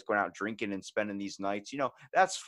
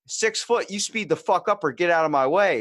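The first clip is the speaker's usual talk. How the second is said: higher and louder, demanding he move; angry confrontation; 6 semitones higher, 7 dB louder than usual. Retold or imitated. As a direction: bark at him to hurry or step aside. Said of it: "I was like".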